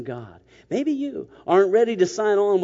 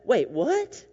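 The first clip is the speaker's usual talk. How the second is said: high, rising mock bewilderment; baffled double take; 6 semitones higher than usual. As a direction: stop short, suddenly lost. Acted Nicodemus suddenly confused.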